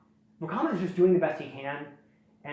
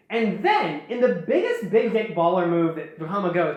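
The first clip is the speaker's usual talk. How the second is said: ordinary talk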